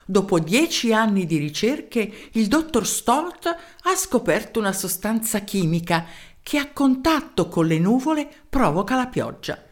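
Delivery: ordinary delivery, nothing marked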